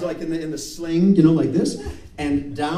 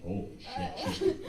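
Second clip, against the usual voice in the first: much softer, stunned murmur; hushed awe; 5 semitones higher, 9 dB quieter than usual. Acted. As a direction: say it under your breath, awestruck. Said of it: "You're just like".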